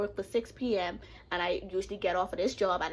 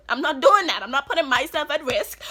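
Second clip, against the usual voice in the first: emphatic; 5 semitones higher, 8 dB louder than usual. Real, not acted. Repeats refusal louder.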